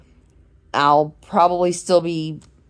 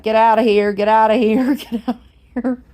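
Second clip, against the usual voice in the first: raised, higher mock-urgent shooing; playful shooing; 6 semitones higher, 5 dB louder, faster than usual. Car wash guy shooing off a crying customer, playful shooing. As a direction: shoo someone off, breaking into a laugh. Retold man shooing her off.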